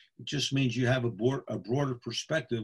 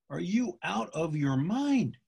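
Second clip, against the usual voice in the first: slow, higher, drawn-out incredulous question; emphatic disbelief; 5 semitones higher, slower than usual. Retold or imitated. Referee scolding his fussy colleague, slow emphatic disbelief. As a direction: spell out the disbelief word by word.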